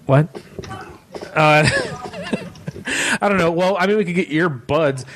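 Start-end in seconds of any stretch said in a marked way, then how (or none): 1.7-3.2 high squeaky laugh. Real, not acted. Laughs at joke about show.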